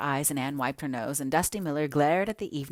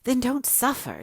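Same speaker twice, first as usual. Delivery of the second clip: unpitying; 8 semitones higher than usual. Acted. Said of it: "she said unpityingly".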